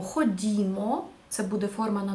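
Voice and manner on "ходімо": ходімо drawn out slowly, as an invitation; inviting ходімо. Shown as a demonstration. Teacher giving students an inviting Ukrainian example, slow. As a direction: stretch ходімо like calling friends along. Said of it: "скажемо".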